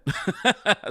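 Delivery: high burst of laughter. Real, not acted. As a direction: laugh at your own flub. Laughs at own bad accent.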